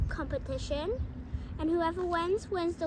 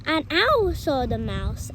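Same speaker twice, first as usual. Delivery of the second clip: usual speaking voice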